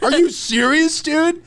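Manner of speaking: hammed-up outrage at the guest; mock angry Serbian. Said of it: "the Serbian fellow was mad".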